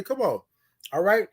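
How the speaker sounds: ordinary talk, nothing marked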